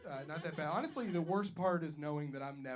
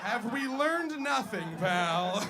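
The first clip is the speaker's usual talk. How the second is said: mock scolding; 5 semitones higher, 7 dB louder, slower than usual. Scolding the crowd member.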